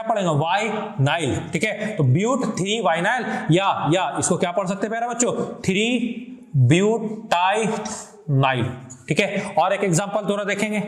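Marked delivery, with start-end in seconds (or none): 5.6-8.6 slow, separated "ब्यूटाइनाइल"; syllable by syllable. Shown as a demonstration. Teacher naming a compound for students, syllable by syllable. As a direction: break "ब्यूटाइनाइल" into drawn-out pieces.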